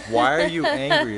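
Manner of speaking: high, loud exasperated yell; retold yell. Husband yelling at his wife mid-argument, shrill and loud. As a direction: throw the question back at her at full volume. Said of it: "You just yelled at me".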